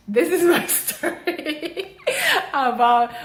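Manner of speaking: high, amused, laughing through the words. Real, not acted. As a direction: voice rising into a laugh. Laughs while wrapping up.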